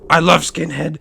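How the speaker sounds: a light imitation of her saying it plainly; imitating her. The woman at the bar, lightly imitated, saying it the first time. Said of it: "And she's like".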